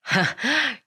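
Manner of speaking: short scoffing chuckle; brief acted snort of derision; scornful laugh. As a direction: laugh off his pride briefly. Scornful laugh before taunting reply.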